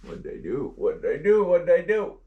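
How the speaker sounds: repeated question climbing in pitch and volume; flustered, building as you fumble; excited fluster. Real, not acted. Presenter fumbling with the maps on air, excited fluster. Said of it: "I'm all excited".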